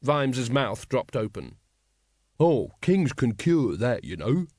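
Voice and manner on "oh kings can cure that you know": slow, knowing aside; drawn-out, know-it-all delivery. A narrator's reading. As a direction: savour being the one who knows. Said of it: "said another proto-monarchist knowingly".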